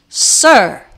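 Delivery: loud, stern bark of one word; loud forbidding rebuke. A narrator's reading. Queen rebukes the kneeling knight, loud and forbidding. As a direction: cut him off with a loud stern warning. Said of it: "The queen's voice was loud and forbidding".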